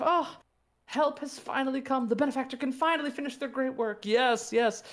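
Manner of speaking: high, overjoyed townsperson; relieved cheering villager. Acted.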